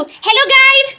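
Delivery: high, bright singsong hello guys, imitating a vlogger; mock vlogger greeting. Aunt imitating the child's vlog greeting, high and chirpy. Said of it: "खूब बोलते तू".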